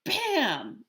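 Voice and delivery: loud, high punch sound; excited. Acted. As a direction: punch the word out. Amos fake punch.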